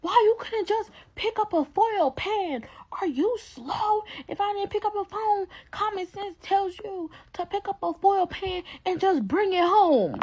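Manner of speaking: high, drawn-out mock scolding; mom yelling. Mom scolding daughter over foil pans, high mock yelling. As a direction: imitate mom's yelling high and slow, dragging each word. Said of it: "She called me yelling in my phone".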